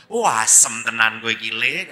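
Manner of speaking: loud comic outburst; mock exasperation. Priest reacts to cheeky friend, mock exasperated outburst. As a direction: blurt it out in mock indignation.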